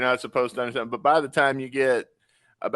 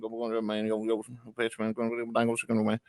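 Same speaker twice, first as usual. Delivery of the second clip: fast slurred mumble, softer and monotone; Boomhauer; 5 dB quieter than usual. Retold or imitated. Friend imitating Boomhauer's cartoon talk for a pal, mumbled and slurred. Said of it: "the whole episode is George Clooney and Boomhauer".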